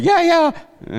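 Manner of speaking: high, loud mock cheer of the men; mock cheering guys. Preacher mimicking the men in the congregation, high mock cheer. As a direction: yelp it like the guys approving.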